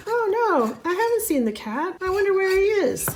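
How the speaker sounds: mock innocent wife. Doll skit, her own doll.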